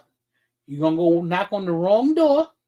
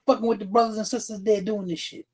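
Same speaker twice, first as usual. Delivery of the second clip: ordinary delivery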